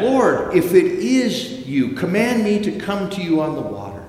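loud, high desperate call across the water; yelling back. A narrator's reading. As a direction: yell it out to someone far off. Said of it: "Peter, yells back".

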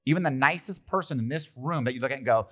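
usual speaking voice

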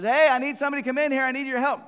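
put-on a mom calling out to the kids; mom calling again. Mom calls her son in to help, high and loud. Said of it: "Mom says".

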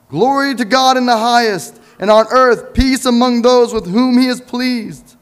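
high, loud exultant proclamation; proclaiming praise. Acted. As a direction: ring it out like a heavenly choir. Angels' praise in reading.

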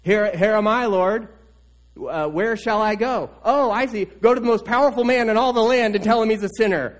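voiced prophet. Preacher voices Nathan answering God's call, a touch eager. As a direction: play the prophet eagerly taking orders.

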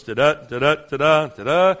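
sing-song da-da mimicking routine prayer; mock the empty prayer rhythm; droning rote cadence. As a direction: hum it like words said without thought. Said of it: "going through the motions".

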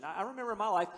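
ordinary delivery

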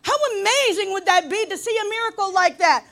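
loud, high exclamation of wonder; emphatic preaching. Real, not acted. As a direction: belt it out to the congregation. Repeats it at full force.

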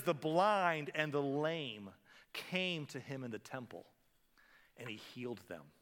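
slow, solemn reading; slow reverent reading; scripture reading. A narrator's reading. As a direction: read it like holy words. Scripture read slowly.

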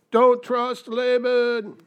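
high, loud, very slow warning; calling a warning. Retold or imitated. Audience off screen warns Jacob with a loud, drawn-out call. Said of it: "we're off screen, we're going".

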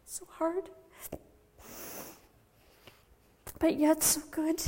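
tender sorrow. Acted. Mother reflects on joy and sorrow.

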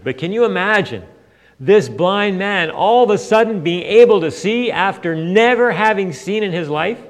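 usual speaking voice

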